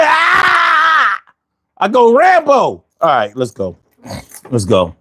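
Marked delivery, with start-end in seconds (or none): 0.0-1.2 long high wailed "Ah"; hammed-up scream of pain. Repeats his pain scream. 1.8-2.5 high-pitched worked-up boast; hammed-up war cry; mock battle cry. War cry after the scream.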